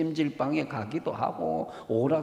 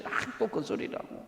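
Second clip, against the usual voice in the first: whispered, fed-up mock grumble; a light imitation of teenage annoyance; annoyed groan; 6 semitones higher, 6 dB quieter than usual. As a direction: sigh it like a bored kid. Child sick of parents' nagging.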